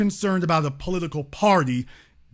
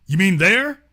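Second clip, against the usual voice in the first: ordinary delivery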